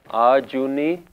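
"Ajuni" with a long "aa" at the start, rising at the end; wrong long first vowel. Shown as a demonstration. Teacher showing how people mispronounce it, long "aa" in "Ajuni". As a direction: stretch the opening of "Ajuni" into "aah-juni". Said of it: "like there's supposed to be a kanna".

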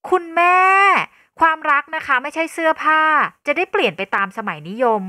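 high, loud indignant blurt easing at the end; loud outburst. Acted. Daughter objecting to her mother's matchmaking, loud outburst. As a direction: burst out at your mother. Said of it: "ทันรดีโพล่งออกไปเสียงดัง".

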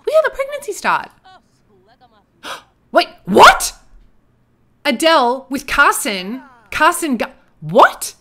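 high, loud gasping disbelief; shocked. Real, not acted. Gamer seeing Adele with Carson, shocked. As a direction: blurt it out, stunned by gossip. Shocked by game's romance news.

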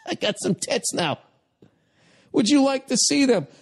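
very high, coy put-on voice; high-pitched comic impersonation; mock flirty parent. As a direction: offer it in a high, silly voice. Acts out dad showing off.

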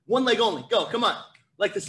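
usual speaking voice

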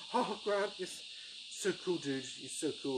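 high, excited fanboy gush. Retold or imitated. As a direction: gush like a starstruck fan. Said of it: "in my fanboy way".

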